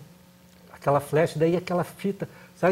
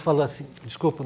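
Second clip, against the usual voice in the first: ordinary delivery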